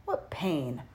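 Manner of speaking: usual speaking voice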